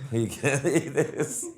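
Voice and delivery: said slowly through a laugh. Retold or imitated. Telling the man he cannot eat the burrito, laughing slowly.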